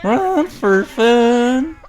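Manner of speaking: very slow, loud, high drawl; cowboy drawl. Pretend. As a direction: stretch it out like an old cowboy. Joins the cowboy voice bit.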